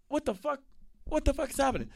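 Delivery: high, startled what-the-fuck; shocked disbelief. Retold or imitated. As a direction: blurt it out in total disbelief. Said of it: "I'm like".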